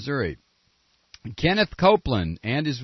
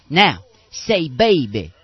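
slow, drawn-out mock preacher command; lightly voiced faith healer coaxing; imitating a preacher; 7 dB louder, slower than usual. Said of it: "And then he'll say".

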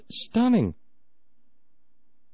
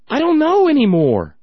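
much higher, louder pleading; exasperated pleading; 11 semitones higher, 4 dB louder than usual. Retold or imitated. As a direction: beg in loud disbelief. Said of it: "I'm sitting here going".

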